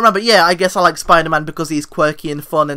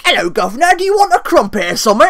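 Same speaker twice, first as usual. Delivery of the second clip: mock British accent; 6 semitones higher, 4 dB louder, faster than usual. Mocking how Americans imitate Brits, exaggerated British accent. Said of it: "American do a British accent".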